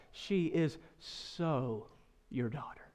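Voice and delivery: a quiet, teasing jab; dry sarcasm. Retold or imitated. Wife teasing husband about their daughter, soft and sarcastic. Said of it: "with some sarcastic humor".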